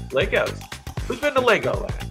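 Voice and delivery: ordinary talk, nothing marked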